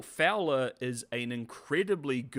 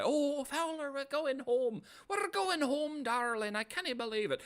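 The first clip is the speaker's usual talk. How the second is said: much higher, sing-song sweetheart voice; mock lovey-dovey; 12 semitones higher than usual. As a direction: coo it like a swooning lover, mocking. Mocking the lovers sailing home.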